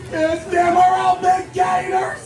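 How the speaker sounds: loud, slow drawn-out shout; team battle cry. Pretend. Team chant tryout on stage.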